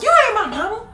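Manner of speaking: put-on high kid's talkback; defiant child. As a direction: snap back like a bratty kid. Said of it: "I was like".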